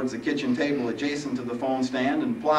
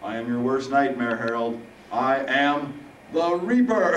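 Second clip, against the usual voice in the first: rising crescendo; 4 semitones higher, 6 dB louder than usual. Acted. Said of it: "As the voice rose to a crescendo".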